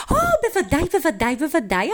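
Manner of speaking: high-pitched, gushing agreement; eager grandma. Acted. Grandma telling Miko he may surely try, eager. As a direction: start on a high delighted "oh", then tumble on.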